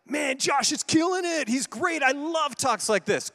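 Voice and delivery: the impressed listener, bright and eager; mock enthusiastic fan. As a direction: gush like a fan loving the talk. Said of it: "Other side of it".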